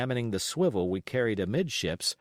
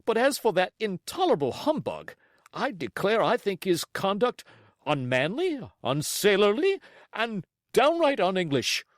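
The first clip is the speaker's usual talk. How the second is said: rising higher, louder outrage; indignant cry; 9 semitones higher, 4 dB louder than usual. Acted. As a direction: squire building to a huff. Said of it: "cried the squire".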